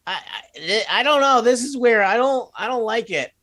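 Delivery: whiny scared little goblin; nervous goblin voice. Acted.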